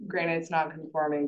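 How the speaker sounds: ordinary talk, nothing marked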